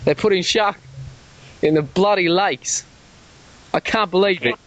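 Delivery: ordinary delivery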